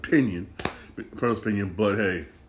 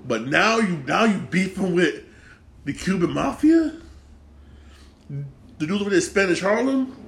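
high-pitched disbelief, climbing into each question; incredulous questioning; 6 semitones higher than usual. Pretend. Fan to Bumpy about beefing with the Cuban Mafia, incredulous. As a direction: question a pal's crazy choice of enemy.

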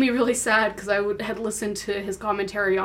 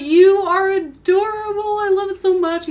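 imitated gushing; 10 semitones higher, 6 dB louder than usual. Voice actor to young cosplayer, imitated high gushing. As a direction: gush over them with pitch way up.

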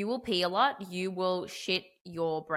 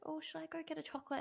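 light, quick tempted thought; high, soft, fast musing; tempted inner voice; 9 semitones higher, 12 dB quieter, faster than usual. Retold or imitated. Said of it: "and I was like".

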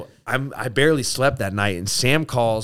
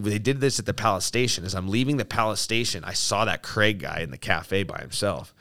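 ordinary talk, nothing marked

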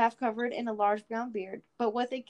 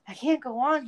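crying out; 4 semitones higher, 7 dB louder than usual. Acted. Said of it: "he cried".

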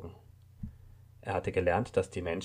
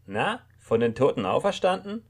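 higher, louder, mocking woman; snippy; 7 semitones higher, 5 dB louder than usual. A narrator's reading. Woman mocks the waking man, snippy and higher. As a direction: tease him sharply as he wakes. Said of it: "fragte sie schnippisch".

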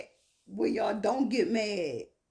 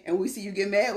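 ordinary delivery

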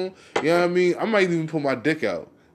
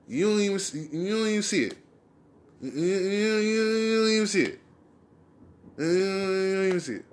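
mock disbelief; 6 semitones higher than usual. Mocking both parties' blindness.

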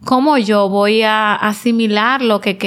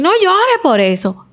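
dismissive scolding; 10 semitones higher, 3 dB louder than usual. Retold or imitated. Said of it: "Y yo no te puedo decir".

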